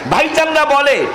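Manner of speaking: high, insistent preacher's cry; emphatic repetition. Real, not acted. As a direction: lift the voice high to call out opponents. Preacher repeats opponents' claim emphatically.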